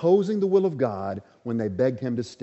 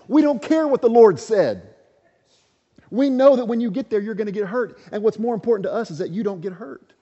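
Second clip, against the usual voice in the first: high, loud, desperate pleading friends; 9 semitones higher, 5 dB louder than usual. Retold or imitated. Friends beg Paul not to go, high and loud. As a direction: cry out to stop Paul going. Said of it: "It's almost as if they said".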